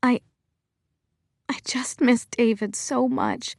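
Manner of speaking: breaking voice, holding back tears; voice cracking. Acted. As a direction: let the voice crack with grief. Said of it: "My voice cracks when I speak".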